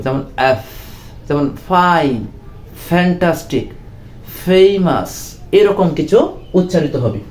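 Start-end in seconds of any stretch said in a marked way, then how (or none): 0.4-5.4 "ফাইন", "ফেমাস" with air pushed out for the F; airy F sound. Shown as a demonstration. Teacher explains air between two lips, airy F demonstration. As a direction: sound the F of "ফ্যান্টাস্টিক" as escaping air. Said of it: "বায়ু বা বাতাস বের হবে".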